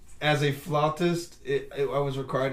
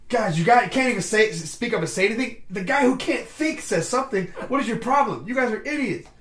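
high, louder scolding of the crew; mock angry rant; 8 semitones higher, 6 dB louder than usual. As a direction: berate the dumb guys. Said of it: "and he's like".

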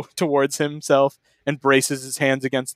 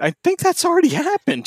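high mocking voice. Real, not acted. Sarcastic aside in a put-on voice.

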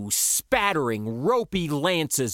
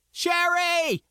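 high, drawn-out shout; urgent cry, pitched way up; 13 semitones higher than usual. A narrator's reading. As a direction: shout her name in warning. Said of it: "I shouted".

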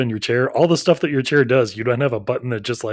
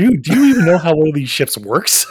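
exasperated; 4 semitones higher, 6 dB louder than usual. Retold or imitated. Mock crew member exasperated.

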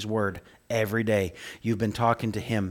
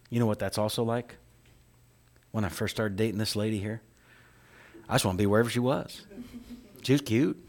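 normal talking voice

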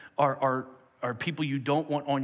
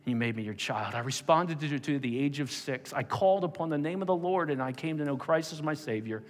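prayer; 3 semitones lower than usual. Acted. Closing prayer.

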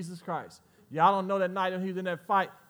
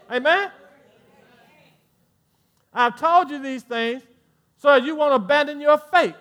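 higher and louder, rising to a preacher's cry; full-force preaching; 7 semitones higher, 8 dB louder than usual. Acted. Preacher warns the congregation, rising to full-force preaching. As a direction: press each warning harder.

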